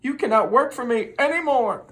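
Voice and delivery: high, loud scolding milkman; cross milkman raising his voice; angry firing. Acted. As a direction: throw him out for good. Angry milkman fires Robert.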